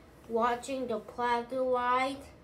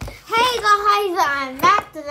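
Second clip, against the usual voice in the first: excited greeting; 10 semitones higher, 16 dB louder than usual. Real, not acted.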